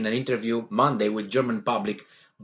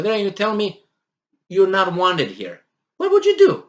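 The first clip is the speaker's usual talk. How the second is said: higher-pitched, exasperated listing of favors; acted aggrieved helper; indignant protest; 5 semitones higher than usual. Acting the rebuffed helper's protest.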